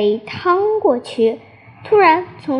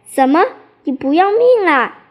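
louder, higher-pitched exclamation; alarmed cry; 6 semitones higher, 3 dB louder, faster than usual. A narrator's reading. Squirrel warns the horse stepping into the river, alarmed cry. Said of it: "又大叫起来".

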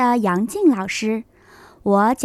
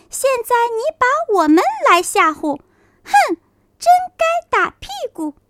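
high, fast teasing laughter; mocking animals; 11 semitones higher, faster than usual. Little animals tease the mouse, high and mocking. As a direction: jeer at the fake tiger.

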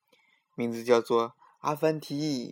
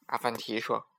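ordinary delivery, nothing marked

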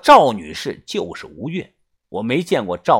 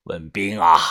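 resigned gang boss; 8 semitones lower than usual. A narrator's reading. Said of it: "把头无奈的摆摆手说".

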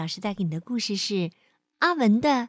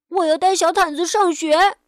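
put-on high, loud little-boy demand; insistent child; 6 semitones higher, 7 dB louder than usual. Boy demands to take his blanket to school, insistent.

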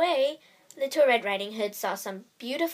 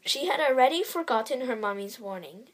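usual speaking voice

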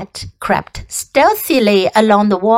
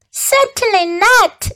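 haughty lamb voice; 11 semitones higher than usual. Acted. Lamb snubbing Wilbur.

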